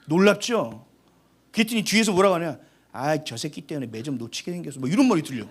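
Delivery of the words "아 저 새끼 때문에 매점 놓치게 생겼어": grumbling classmate. Retold or imitated.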